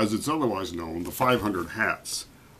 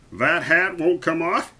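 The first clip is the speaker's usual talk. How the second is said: raised taunt ending in a laugh; scoff, then laugh meanly; 6 semitones higher, 3 dB louder than usual. A narrator's reading. Young duke mocking the boy's hat, laughing meanly. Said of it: "laughing meanly".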